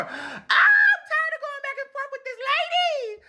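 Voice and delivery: very high, drawn-out screech of frustration; exasperated scream. Acted. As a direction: scream it out, fed up with arguing. Acted outburst at nagging mother.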